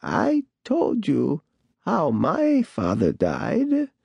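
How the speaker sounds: put-on slow, high, creaky old woman; a slow, eerie crone voice. A narrator's reading. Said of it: "she said".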